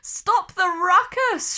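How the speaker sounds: angry squirrel yells; shouting squirrel. A narrator's reading. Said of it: "shouted the squirrel".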